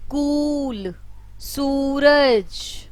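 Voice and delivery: कूल and सूरज with the ऊ drawn out slowly; long oo vowel demo. Shown as a demonstration. Teacher to pupils, lengthening the long ऊ. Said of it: "उच्चारण थोड़ा सा दीर्घ हो जाता है".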